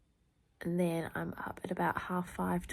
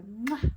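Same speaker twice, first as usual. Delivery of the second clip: exaggerated kiss sound; loud smacking mwah sign-off; loud cartoonish kiss noise; playful mwah; 4 semitones higher, 8 dB louder than usual. Real, not acted. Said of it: "I always go".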